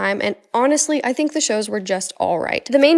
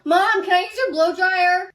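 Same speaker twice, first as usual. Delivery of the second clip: calling out; 8 semitones higher, slower than usual. Acted. Teen calling to mom.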